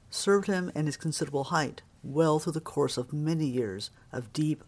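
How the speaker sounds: plain reading voice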